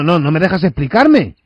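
ordinary talk, nothing marked

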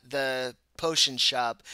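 ordinary talk, nothing marked

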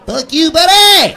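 high, loud comic yell; hammed-up outburst at a child; mock angry parent. Parent cursing out their own kid, hammed-up yell.

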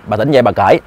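ordinary delivery, nothing marked

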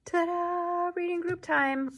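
high, loud and bright; cheery teacher fanfare; excited announcement. Acted. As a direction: announce it like a treat. Teacher announces reading time.

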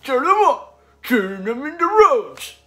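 blustering cartoon steamroller with big swooping pitch; George the Steamroller. YouTuber performing today's impression, a railway-hating steamroller's blustering voice. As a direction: rant it in swoops, punching each phrase. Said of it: "George the Steamroller".

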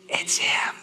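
whispered aside, as if letting them in on a secret; stage whisper. Pretend. Pastor whispers the obvious answer to the congregation, stage whisper.

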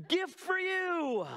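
high, slow sing-song gift for you; a sarcastic husband presenting a mess as a present; mock sweet offer. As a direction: hand it over with fake sweetness. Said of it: "me saying to my wife".

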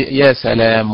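normal talking voice

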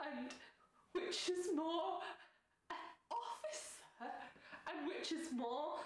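high-pitched, indignant stage declamation; bumbling officer defending his dignity, pausing grandly between claims; Dogberry, hurt pride. Acted. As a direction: list his merits with wounded self-importance. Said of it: "sense of him trying to maintain dignity, though he's been deeply hurt".

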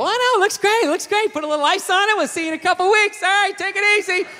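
high, loud breezy reassurance; lying surgeon. Acted. Plastic surgeon dismissing a botched patient, high and loud. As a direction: brush the patient off cheerfully.

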